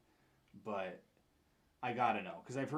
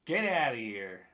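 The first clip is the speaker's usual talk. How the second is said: loud, indignant dismissal; sudden loud burst of exasperation; exasperated shout; 2 semitones higher, 11 dB louder than usual. Pretend. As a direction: shout it off in mock outrage. Mock outburst at Spotify's song picks.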